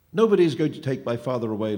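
loud, defiant outcry; crying out. A narrator's reading. Boy defends father against the phantom, crying out. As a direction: cry it out forcefully in defiance. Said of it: "Luca cried".

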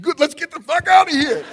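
high, loud relieved yell; relieved outburst. Retold or imitated. Escaping the proposal moment, a high loud relieved shout. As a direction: blurt out relief and bolt.

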